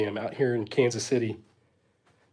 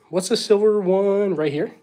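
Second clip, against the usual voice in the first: ordinary talk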